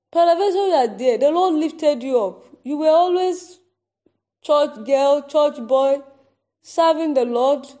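prophetic proclamation. Acted. Prophetic warning to congregation.

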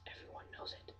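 hushed conspiratorial whisper; breathy secret whisper. Acted. Conspiratorial aside about coins.

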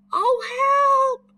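high, loud cry for help; frightened call. A narrator's reading. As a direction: shriek out in fright. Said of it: "he called".

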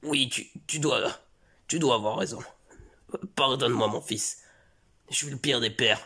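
mock remorseful father. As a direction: apologise like the worst father alive. Father repents.

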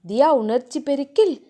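ordinary delivery

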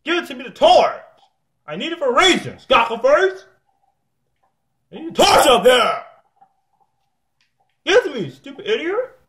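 very high, heated demand; angry demand. Real, not acted. Player demanding a torch for the house, angry and high.

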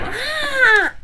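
high, drawn-out yell; acted scream. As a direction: let out a high "ah".

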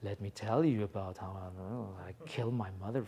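low, soft and sinister; a hint of a dark, creepy killer. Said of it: "there's something dark".

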